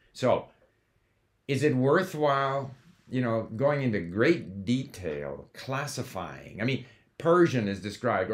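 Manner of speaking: normal talking voice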